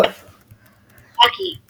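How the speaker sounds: name muttered too soft to catch, far from the mic; barely said under the breath; mumbled name. Real, not acted.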